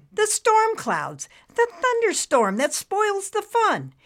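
animated cartoon cloud explaining; lively cloud character. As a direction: play a theatrical storybook cloud.

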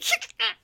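short high-pitched cackle; cackling laugh. A narrator's reading. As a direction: cackle after your own boast. Junior's laugh after boasting.

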